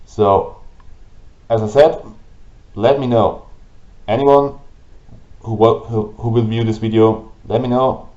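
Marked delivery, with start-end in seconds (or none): none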